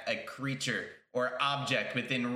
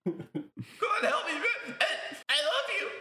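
very high, louder half-laughing panic; desperate plea; 4 dB louder, slower than usual. Acted. Drowning creature pleading with Dennis, high laughing panic. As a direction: beg for help while being carried off.

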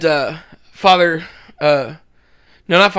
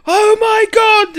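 high, slow, exaggerated groan of shock; dramatic gasp; 6 dB louder, much slower than usual. Retelling Father Jack waking sober, dramatic gasp. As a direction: stretch it out in a shocked old priest wail.

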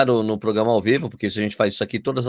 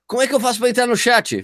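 quoted viewer; 11 semitones higher than usual. Retold or imitated. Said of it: "o cara".

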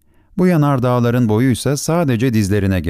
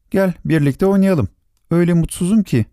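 little prince voice; 8 semitones higher than usual. Acted. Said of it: "dedi Küçük Prens".

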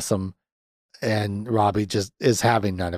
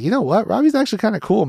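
higher, breezy imitation of Holly; a lightly voiced Holly, higher; mock Holly voice; 11 semitones higher, faster than usual. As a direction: toss it off like a flirty friend. Voicing Holly in recap.

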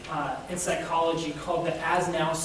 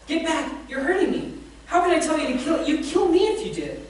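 higher, louder cry of pain and fear; panicked ghost; 8 semitones higher, 6 dB louder than usual. A narrator's reading. The ghost cries out at the angel, high and panicked. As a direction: recoil in panic.

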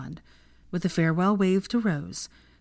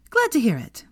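perky, higher-pitched chirp; pleased with oneself, pitched up; brightly; 7 semitones higher, 4 dB louder than usual. A narrator's reading. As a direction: switch suddenly to breezy cheer. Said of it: "she said brightly".

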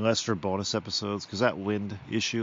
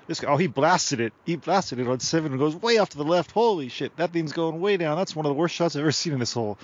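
louder, higher play-by-play; excited mock commentary; 4 semitones higher, 4 dB louder than usual. Commentator watching a shot go way left, mock excitement. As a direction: call the wild shot with hammed-up astonishment.

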